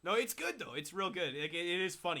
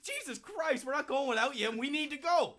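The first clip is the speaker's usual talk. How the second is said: high-pitched exasperated insistence; mock exasperation; 10 semitones higher than usual. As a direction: act out refusing to leave a friend behind. Acting out losing patience with friend.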